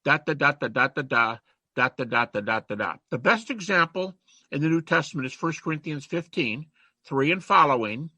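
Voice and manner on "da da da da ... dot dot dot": teacher showing a creed's cadence with nonsense syllables; cadence demo. Shown as a demonstration. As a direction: tap out the rhythm of the Greek line. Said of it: "it's gonna have a cadence to it".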